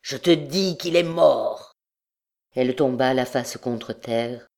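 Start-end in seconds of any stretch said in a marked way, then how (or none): 0.0-1.7 strained cry of rage and despair; contained but anguished, rasping outburst. A narrator's reading. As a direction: cry it out in desperate anger. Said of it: "cria avec un râle de rage et de désespoir".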